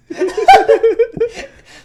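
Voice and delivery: loud, high-pitched laugh; burst of laughter. Real, not acted. Showing friends the robot puppeteer picture, laughing hard. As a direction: crack up out loud, real.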